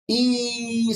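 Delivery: "Y" as a long, high, steady ee; continuous vowel. Shown as a demonstration. Teacher testing vowels with students, clear sustained sound. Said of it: "hay una continuación".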